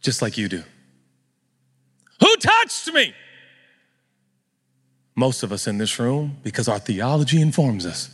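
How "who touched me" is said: loud-sounding demand, pitched very high; stern Jesus. Acted. Jesus confronting whoever touched him, stern. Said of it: "we hear him in his stern voice".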